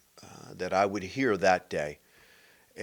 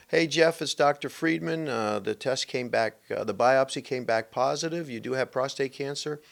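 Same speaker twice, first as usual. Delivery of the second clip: matter-of-fact doctor; 6 semitones higher than usual. Retold or imitated. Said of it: "he just shared it very matter of fact".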